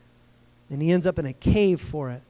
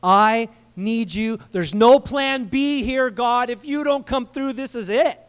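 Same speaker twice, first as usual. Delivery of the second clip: higher, louder, slow pleading cry; urgent desperate prayer; 5 semitones higher, 4 dB louder, slower than usual. Shown as a demonstration. Preacher acting out a desperate prayer, urgent and pleading. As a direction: beg like there is no plan B. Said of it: "crying out to the Lord connotates passion, urgency".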